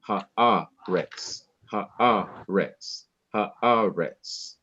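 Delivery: haaretz with a long stressed A, slight break, then RETZ; pause and stress. Shown as a demonstration. Teacher showing the siluk in the verse's last word, haaretz held with a pause. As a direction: stretch the A of haaretz before letting RETZ fall. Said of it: "there is to be a pause".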